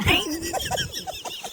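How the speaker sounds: a shrill cackling burst; manic high laugh. Acted. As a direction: laugh like you've lost it. Laugh after yelling.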